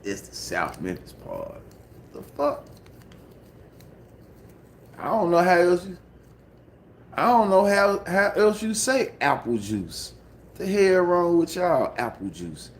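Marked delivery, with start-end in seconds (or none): 2.1-2.6 incredulous. Real, not acted. Exasperated outburst. 5.0-12.7 exasperated. Real, not acted. Exasperated at audience.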